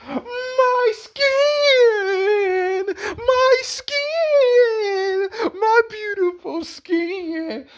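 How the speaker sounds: very high, wailing cry over her skin; hammed-up sobbing wail; mock wailing. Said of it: "[name] was just crying about her beautiful skin".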